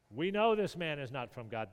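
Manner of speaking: imitating the Pharisees' smug certainty; confident claim. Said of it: "They speak with incredible confidence".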